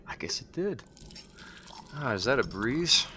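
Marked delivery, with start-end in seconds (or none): 0.1-0.8 whisper. Acted. Unsettled whisper as it gets cold.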